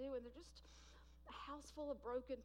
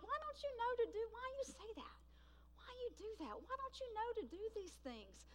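high-pitched, softer mock complaint; pitched-up, incredulous pleading questions; acted exasperation; 9 semitones higher, 4 dB quieter than usual. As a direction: whine in exasperation, imitating your own frustration. Acting out exasperation with people.

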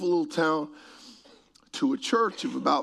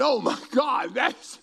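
stunned gratitude; 10 semitones higher, slower than usual. Retold or imitated. Preacher reliving the forty-thousand check, high and overwhelmed. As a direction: gasp out thanks in disbelief.

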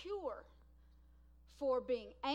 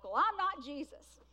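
loud, mock-defensive comeback; acted-out protest, punched loud; retold young retort; 3 semitones higher, 10 dB louder than usual. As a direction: push back loudly, imitating your younger self.

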